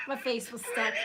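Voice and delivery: cracking up mid-sentence; helpless giggle through words; laughing. Real, not acted. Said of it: "[name]'s cracking up".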